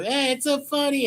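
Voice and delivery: high mocking sing-song, way up; sarcastic high imitation of drunks; mocking drunk laughter. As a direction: mimic their drunken giggly glee.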